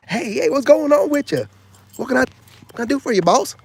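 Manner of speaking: high, loud over-eager greeting; mock fawning guy; eager simp voice. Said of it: "you thought I was gonna just be like".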